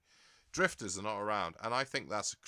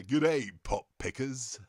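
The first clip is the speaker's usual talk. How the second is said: slow, louder seventies Radio One DJ greeting, Essex-free; Fluff Freeman DJ; 4 semitones higher, 6 dB louder, slower than usual. Shown as a demonstration. Demoing his game voice to stream viewers, slow louder seventies DJ. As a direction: stretch it out like Alan Freeman opening his show. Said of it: "I have to do it in the style of".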